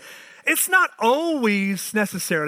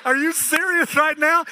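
incredulous exclaim; 8 semitones higher, 4 dB louder than usual. Retold or imitated. Incredulous reply to wife.

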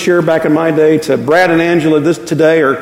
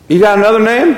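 gruff rancher grandfather; 5 semitones higher than usual. Retold or imitated. Grandfather grilling the hippie boyfriend, in a mock gruff rancher voice. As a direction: talk like a grandfather sizing up a hippie kid. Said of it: "he looks at me and goes".

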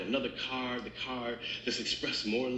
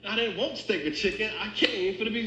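acted-out customer complaint, pitched up; indignant protest; 7 semitones higher than usual. Hungry customer refuses the substitute, indignant protest. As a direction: push back in mock outrage. Acted quarrel over missing beef.

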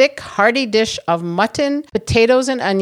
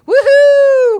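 excited cheer; 7 dB louder than usual. Real, not acted. Announcing first podcast giveaway.

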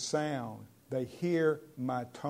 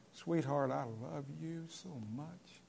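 very soft, tender declaration of love; sincere, loving tone; 10 dB quieter than usual. Shown as a demonstration. Preacher showing the right tone to a sweetheart, soft and sincere. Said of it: "See, that's a lot different".

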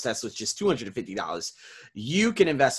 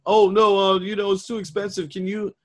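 much higher, louder mock complaint; mocking whine; 7 semitones higher, 5 dB louder than usual. Coach mocks the client's complaint, pitched way up. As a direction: mimic the client's whiny excuse. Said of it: "have the audacity to say".

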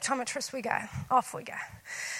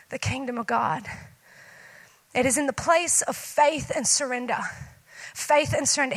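ordinary talk, nothing marked

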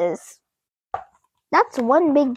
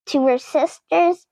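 usual speaking voice

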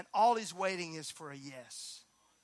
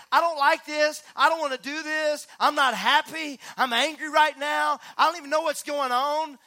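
loud, raised and pleading, at full preaching force; voicing frustration; 6 semitones higher, 9 dB louder than usual. Preacher to congregation, loud venting of frustration. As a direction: let the complaint out loud. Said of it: "It's okay saying".

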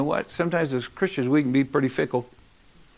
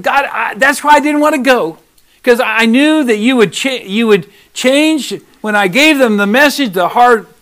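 higher, louder, lightly voiced sulking prophet; pouting Jonah; 5 semitones higher, 8 dB louder than usual. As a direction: complain like a sulky child to God. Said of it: "Jonah was pouting".

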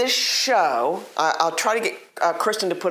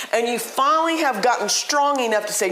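ordinary talk

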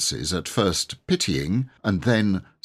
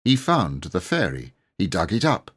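plain reading voice

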